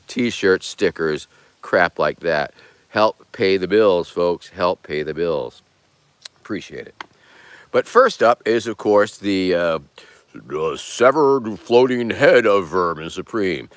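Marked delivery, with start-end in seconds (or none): none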